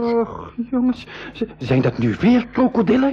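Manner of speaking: high, startled cry of alarm. Acted. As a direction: gasp it out in fright at the crocodiles. Boy spots crocodile horde.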